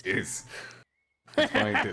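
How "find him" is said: high laughing order. Acted. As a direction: squeal it through laughter. Npc urging, laughing.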